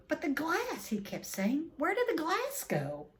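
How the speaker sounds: high, bewildered repeating; a stunned keeper pleading; shocked disbelief. A narrator's reading. Zoo keeper staring at the missing glass, high and shocked.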